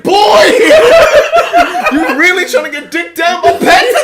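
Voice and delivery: shouted through laughter, high; cracking up while yelling it; laughing. Retold or imitated. Teasing a guy on dancefloor.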